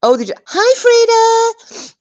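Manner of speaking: a bright sing-song greeting, drawn out high and loud; quoting her friend. Retold or imitated. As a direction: lift right up into a cheerful high hello. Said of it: "And she said".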